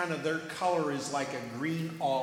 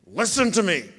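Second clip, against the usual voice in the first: louder stern parent voice; parent scolding; 7 dB louder than usual. Retold or imitated. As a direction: tell them off like a parent. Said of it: "Your moms and dads, do you ever get this?".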